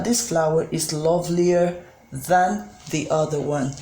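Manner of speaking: normal talking voice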